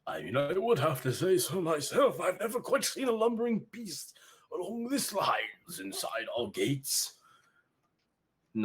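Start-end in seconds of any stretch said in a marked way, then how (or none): 0.1-7.1 high, far-off snooty tone, slowing at the end; a pompous townsman agreeing grandly; haughty villager. Acted. Villager marveling at the beast, high and haughty. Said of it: "Another one".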